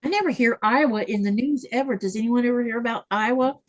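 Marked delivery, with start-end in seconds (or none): none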